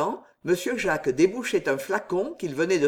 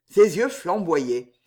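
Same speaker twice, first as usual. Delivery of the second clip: plain reading voice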